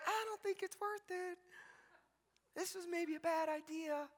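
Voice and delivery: high, soft, mock-scared squeak; acted cowering, high and hushed; timid hiding voice. Voicing disciples hiding in a room.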